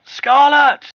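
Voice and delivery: high, loud shout of a name; crying out. Acted. Refugee crying out for a child fallen overboard, high shout. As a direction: cry out the name in alarm. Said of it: "Someone among the refugees cries out".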